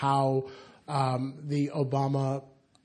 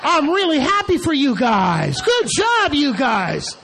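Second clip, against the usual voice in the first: much higher and louder, a hammed-up cheer; mock gushing; 11 semitones higher, 9 dB louder, slower than usual. Mimicking friends congratulating her over the phone, with hammed-up loud joy.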